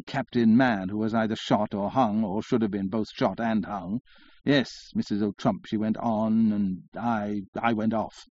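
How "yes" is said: ordinary delivery, nothing marked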